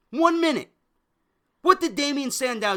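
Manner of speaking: high, loud outraged cry. Real, not acted. Fan outraged Sandow lost in a minute, loud cry. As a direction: shout it in disbelief.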